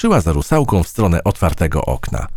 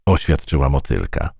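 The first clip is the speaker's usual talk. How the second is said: ordinary delivery, nothing marked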